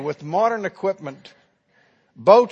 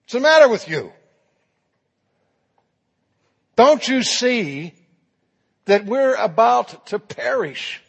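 loud, higher, frightened scolding; scared rebuke; 6 semitones higher, 4 dB louder than usual. Pretend. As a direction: shout at him in panic over the storm. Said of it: "And they rebuke him".

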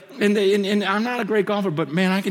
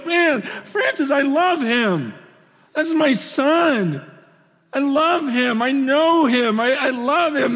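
slow, high, proud loving father God; lightly voiced God, tender and slow; God's imagined voice; 7 semitones higher, 4 dB louder, slower than usual. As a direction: linger on love like a proud father. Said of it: "God would look down ... and go".

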